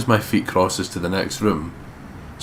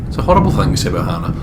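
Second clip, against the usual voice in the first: fast, higher, louder mock outrage; mock-scandalised; 10 semitones higher, 7 dB louder, faster than usual. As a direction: pretend to be shocked.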